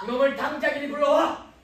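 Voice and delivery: heated shouted order; angry order. Acted. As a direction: demand it at once, furious. Angry father demands the suspect.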